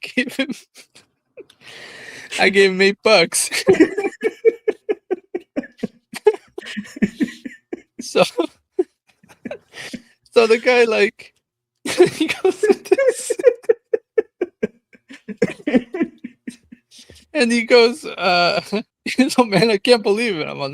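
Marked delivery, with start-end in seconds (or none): 0.0-10.3 words broken by laughter, louder; can barely get it out for laughing; laughing hard while telling it. Real, not acted. Laughing at his own story. 10.3-20.5 high, loud, laughing through the words; giggling through the retelling. Real, not acted. Cracking up while retelling.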